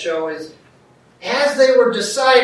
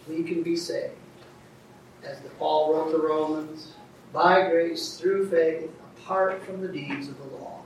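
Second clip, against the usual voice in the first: lower, softer, reverent praying; closing prayer; 5 semitones lower, 5 dB quieter than usual. Real, not acted. Preacher thanking Heavenly Father for teaching, quiet prayer. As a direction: pray quietly in measured phrases.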